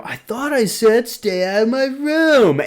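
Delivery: high, put-on voice of a bratty kid brother; annoyed little brother. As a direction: whine it like a kid yelling at his sister. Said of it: "You hear the brother go".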